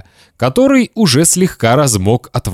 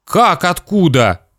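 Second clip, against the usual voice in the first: put-on higher, hurt child's retort; offended boy; 7 semitones higher than usual. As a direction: answer dad, slightly affronted. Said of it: "обиделся Славик".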